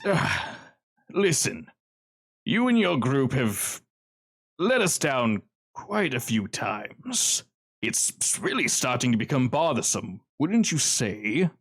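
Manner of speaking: lightly voiced smug villain, unhurried; Kincaid's menacing drawl. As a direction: scold them slowly like a bored mob boss.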